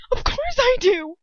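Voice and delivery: high, loud and urgent. A narrator's reading. Hermione answering Ron's accusation, urgent. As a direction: insist urgently, pitch right up. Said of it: "I say urgently".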